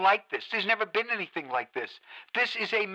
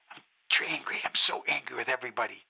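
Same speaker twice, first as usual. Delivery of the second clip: much lower and softer, bitter and drained; quiet seething despair; 9 semitones lower, 6 dB quieter than usual. Acted. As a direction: let the anger collapse into a low murmur. Host sinks into bitter despair.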